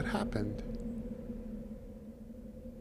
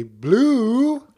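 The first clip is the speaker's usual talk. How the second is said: high, loud sing-song call; 11 semitones higher, 7 dB louder, much slower than usual. Acted. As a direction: call the fish up from the pond. Man calls his fish from pond.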